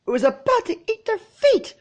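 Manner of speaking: high panicked mare's screech; screeching unicorn. A narrator's reading. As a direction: screech it like a hysterical pony. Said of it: "screeched the unicorn".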